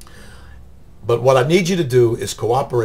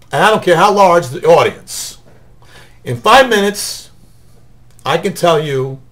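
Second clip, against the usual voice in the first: loud, rising announcer build; showman pitch; 3 semitones higher, 5 dB louder than usual. Acted. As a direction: sell it to the room like a seminar host. Announcer-style pitch to audience.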